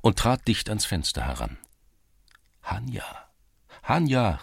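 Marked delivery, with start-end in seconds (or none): 2.6-3.1 a soft, rising, hushed first call of her name. A narrator's reading. Cossack calling softly up to the sleeping girl, rising. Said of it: "rief er". 3.8-4.4 now call her name out properly. A narrator's reading. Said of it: "rief er".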